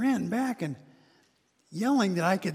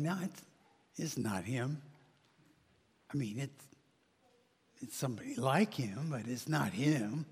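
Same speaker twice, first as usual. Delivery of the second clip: doubtful neighbor; 4 semitones lower, 6 dB quieter than usual. Acted. Neighbors doubting, voiced lower.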